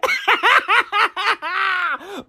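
high, helpless laugh; wild laughter. Real, not acted. Replaying the clip and cracking up, wild laughter. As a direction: crack up hard.

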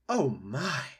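mild theatrical gasp; lightly acted shock. A narrator's reading. Opossum seeing the robot in moonlight, mildly gasping. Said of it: "the opossum gasped".

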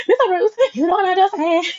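high, teary, laughing whine; whiny crying plea. Retold or imitated. Pleading again, retold.

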